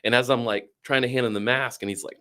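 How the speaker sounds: ordinary talk, nothing marked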